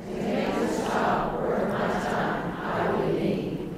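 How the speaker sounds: soft, slow whisper of the phrase; rehearsing the line under her breath; whispered repeat. Shown as a demonstration. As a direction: breathe it out slowly, barely voiced.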